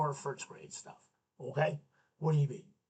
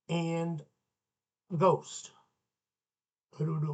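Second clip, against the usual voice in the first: ordinary talk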